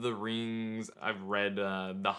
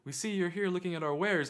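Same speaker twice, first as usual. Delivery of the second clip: lightly voiced old-time merchant, pitched higher; Renaissance fair vendor; 7 semitones higher than usual. Vendors greet a browsing visitor, lightly put-on Renaissance talk. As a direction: greet a customer in mild mock role-play. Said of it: "they're like".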